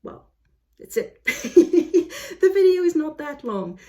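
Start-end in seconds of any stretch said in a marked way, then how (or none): 1.2-2.4 short bright laugh. Real, not acted. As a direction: burst out laughing. Laughs at her own rush.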